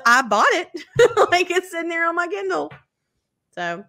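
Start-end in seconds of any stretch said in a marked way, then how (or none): 0.0-2.7 laughing. Real, not acted. Laughing at her own purchase.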